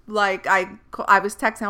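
usual speaking voice

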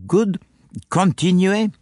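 fussy little Belgian asking his friend; French-accented Poirot. A narrator's reading. Poirot urges Japp on.

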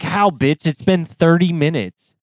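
indignant retort. Retold or imitated. Patient snapping back at a hasty therapist, mock indignant. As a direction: scoff back in disbelief.

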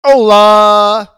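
high, loud, drawn-out "Hola!"; big held-out holler; shouted greeting. Real, not acted. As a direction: belt out a hello to the listeners. Co-host's shouted greeting on introduction.

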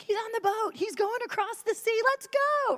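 the crowd spotting Jesus, getting hyped; excited crowd. Retold or imitated. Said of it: "They're like".

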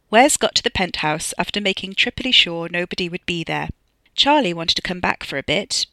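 usual speaking voice

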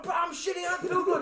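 high, laughing plea; retelling his own sick plea, laughing. Retold or imitated. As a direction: whine it while laughing.

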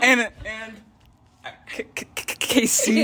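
stuttering on Casey. Real, not acted. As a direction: stumble over the K in Casey. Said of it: "That just sounded like a stutter".

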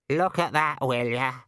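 squawky raven complaint; rhetorical raven. A narrator's reading. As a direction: throw out a rhetorical grumble as a bird. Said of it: "said the raven rhetorically".

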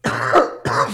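loud choking cough; harsh coughing fit. Real, not acted. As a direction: cough hard from the fumes. Fumes after opening alcohol.

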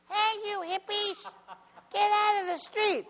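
giant guard with a tiny, very high voice; security guard calling out in a squeaky high voice. Retold or imitated. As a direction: squeak it out like a little boy. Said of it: "What happened to your voice?".

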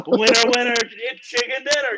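high, hammed-up Southern twang. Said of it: "us South Carolina rednecks".

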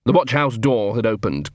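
ordinary delivery

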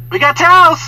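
high, loud call through a door; movie maid. Retold or imitated. Maid offering towels to sleeping guest, high calling voice. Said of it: "she goes".

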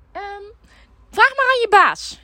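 put-on high, slow bossy old lady; high demanding old lady. Caller lady voiced in story.

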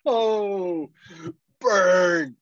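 high, loud mock "burn" call; calls out the burn with a gleeful yelp. Real, not acted. Cohost reacting to dad's insult, gleeful yelp.

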